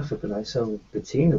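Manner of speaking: normal talking voice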